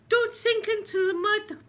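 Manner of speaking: put-on high, slow desperate boy's plea; pleading boy. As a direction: beg the horse not to sink, high and slow. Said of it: "whatever his name was".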